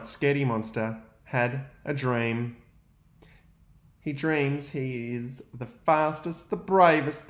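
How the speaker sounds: ordinary talk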